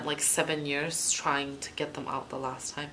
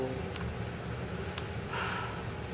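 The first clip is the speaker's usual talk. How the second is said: soft, drawn-out breathy sigh; long sigh; 3 semitones lower, 8 dB quieter than usual. Real, not acted. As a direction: let out a long, quiet sigh. Pauses over sounding spoiled.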